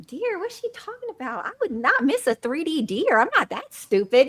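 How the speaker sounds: high-pitched, incredulous retelling; retold indignant disbelief. New contractor reacting to property manager's odd complaint, incredulous. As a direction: act out a baffled, offended inner rant.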